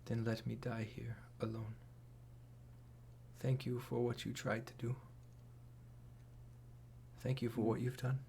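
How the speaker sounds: faint, fading breaths of a dying woman; weak dying voice. Acted. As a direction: whisper it like your last words.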